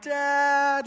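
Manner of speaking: high, mock-crying call; imitated crying child. Son calling to dad after a knock, mock crying. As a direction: wail it like a hurt little boy. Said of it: "like, just crying".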